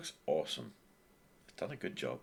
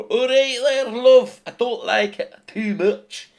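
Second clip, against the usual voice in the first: a terrible, hammed-up Scottish accent, high and loud; slow, overdone mock Scottish accent; 15 dB louder, slower than usual. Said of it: "maybe you're Scottish and you go".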